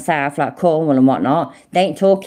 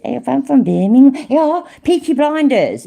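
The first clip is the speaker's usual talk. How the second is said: a quick, hammed-up Birmingham accent, higher and louder; Brummie accent; 11 semitones higher, 4 dB louder than usual. Streamer to chat, trying a Birmingham accent badly. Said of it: "The best I can do is".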